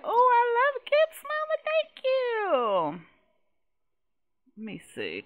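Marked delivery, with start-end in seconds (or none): 0.0-3.0 put-on high, squeaky little girl thanking mama; child voice. Player voicing the toddler thanking mom, high and sweet. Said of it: "She like".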